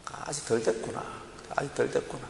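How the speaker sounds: soft self-talk, barely voiced; quiet inner voice. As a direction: murmur it as a private realization.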